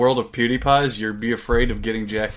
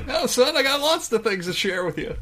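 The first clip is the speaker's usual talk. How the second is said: much higher, laughing dad voice; impression of Charlie's dad; 11 semitones higher than usual. Laughing dad answering his son, pitched up. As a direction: play the dad cheerfully lecturing his son. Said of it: "I do a spot-on impression of your dad".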